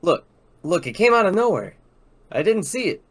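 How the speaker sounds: defensive. Acted. Ray defends himself after crash.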